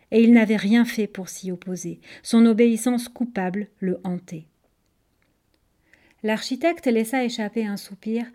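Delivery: ordinary delivery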